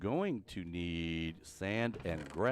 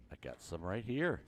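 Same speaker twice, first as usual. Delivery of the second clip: usual speaking voice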